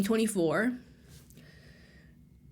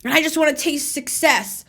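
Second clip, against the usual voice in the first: higher-pitched whiny complaint; mock whining; 4 semitones higher than usual. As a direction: whine it as a mocking self-parody. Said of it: "you're whining and complaining".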